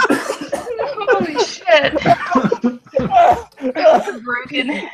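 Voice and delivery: words squeezed out through laughter; slow, laughing through the words. Real, not acted. Cracking up after French bit.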